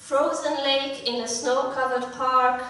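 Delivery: ordinary talk, nothing marked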